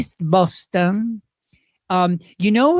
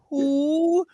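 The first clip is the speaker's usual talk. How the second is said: [name] impression; 8 semitones higher than usual. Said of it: "that was my version of [name]".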